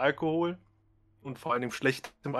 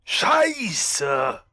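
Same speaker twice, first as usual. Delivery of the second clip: put-on groaning drunk; 7 semitones higher, 6 dB louder, much slower than usual. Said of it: "Und leise stöhnen so".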